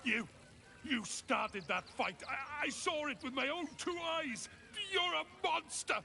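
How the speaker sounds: high, frantic, near-shouting grief; grief-crazed. Acted. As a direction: accuse them through shock and loss. Circus worker grieving a dead friend.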